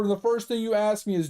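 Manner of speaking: ordinary talk, nothing marked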